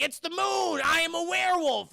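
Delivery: high, loud mock-crazed proclamation; over-the-top high shout; dramatic outcry. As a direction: wail it like a hammed-up werewolf confession. Acts out werewolf's dramatic declaration.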